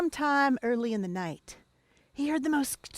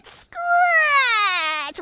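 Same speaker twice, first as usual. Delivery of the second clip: drawn-out scratch, louder and higher at the end; scary scratching; 13 semitones higher, 7 dB louder, much slower than usual. A narrator's reading. Teller startling listeners with the scratching, rising to a loud high scratch. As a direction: build the scratches to a jump scare.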